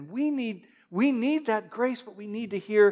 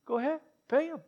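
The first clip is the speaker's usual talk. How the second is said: casual Jesus; 6 semitones higher, 7 dB quieter, faster than usual. Retold or imitated. Jesus answers about taxes.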